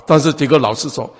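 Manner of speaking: normal talking voice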